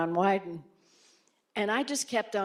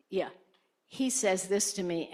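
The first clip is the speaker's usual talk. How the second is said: usual speaking voice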